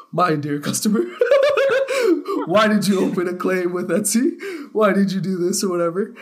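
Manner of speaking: high, loud, laughing through a mock complaint; barely holding it together while imitating the seller; giggling imitation of the seller; laughing mimicry.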